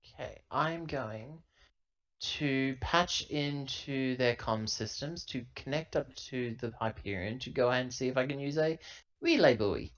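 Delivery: usual speaking voice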